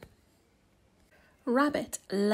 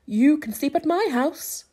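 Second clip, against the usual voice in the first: calling out; 4 semitones higher, 6 dB louder than usual. A narrator's reading. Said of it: "called Beaver".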